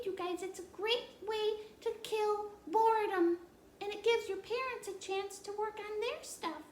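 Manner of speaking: put-on high, chirpy little girl; child puppet voice. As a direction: play the curious puppet child. Puppet Annie voice.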